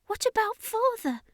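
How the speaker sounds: put-on high, small boy's voice; a worried child asking; young boy. A narrator's reading. Boy asks about his father.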